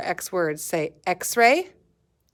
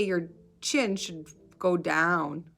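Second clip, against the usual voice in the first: normal talking voice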